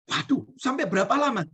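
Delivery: high, dismayed wife; wife's voice. Acted. Wife asking the shaman how long, high and dismayed.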